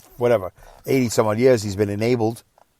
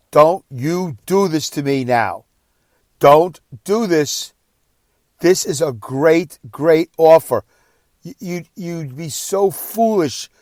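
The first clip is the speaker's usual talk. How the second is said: slow, emphatic, higher-pitched scolding; retold exasperated yell; 6 semitones higher, 4 dB louder, much slower than usual. Son pleading with deaf dad to accept help, exasperated yelling. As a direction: plead hard, leaning on each word. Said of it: "I started yelling".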